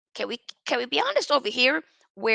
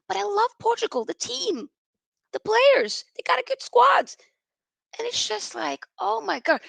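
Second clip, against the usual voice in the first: excited; 9 semitones higher than usual. Real, not acted. Passionate defense of Portugal.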